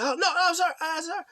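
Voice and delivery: high-pitched, panicky pleading; hammed-up squeaky apology; mock frantic apology. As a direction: imitate someone scrambling to apologize.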